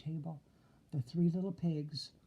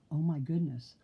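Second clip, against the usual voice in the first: usual speaking voice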